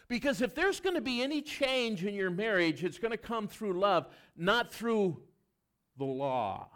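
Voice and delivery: preaching at full force. Acted. Pastor urges married couples to begin with love, preaching loudly. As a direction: pitch it high and loud to the congregation.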